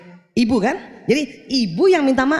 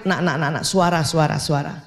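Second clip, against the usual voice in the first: soothing mother; 5 semitones lower than usual. Acted. Mother calming child's voice.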